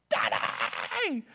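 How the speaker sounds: high, squealed mock cry for daddy; little girl's scream. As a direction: imitate a little girl shrieking for dad. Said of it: "the little girl screams".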